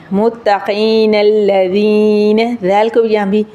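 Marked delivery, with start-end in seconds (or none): 0.1-2.6 very slow linked "متقین الذین" with light th-like ذ; drawn-out recitation, thin ذ and long ee in "الذین"; thin dhaal, joined. Shown as a demonstration. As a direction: flow from "متقین" into "الذین" without stopping. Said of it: "ہم باریک پڑھیں گے".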